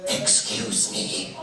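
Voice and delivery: low hushed whisper. Retold or imitated. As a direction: whisper so the singing isn't disturbed. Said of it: "she went".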